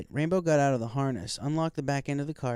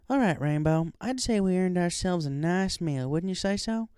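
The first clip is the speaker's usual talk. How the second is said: lightly voiced country mare, higher; a hint of a friendly farm pony, raised pitch; Applejack; 7 semitones higher, 4 dB louder than usual. A narrator's reading.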